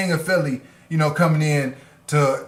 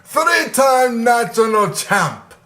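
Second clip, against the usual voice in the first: hammed-up Russian accent promo, higher and slow; bad Russian accent; 7 semitones higher, 3 dB louder, slower than usual. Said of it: "I don't know if that's a good Russian".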